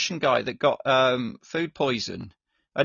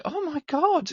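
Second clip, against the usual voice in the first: high, startled "oh my God" from the nurses; shocked gasp; 13 semitones higher, slower than usual. Retold or imitated. Nurses reacting to dad's joke, shocked exclamation. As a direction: play the nurses, caught off guard by the joke. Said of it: "the nurses were like".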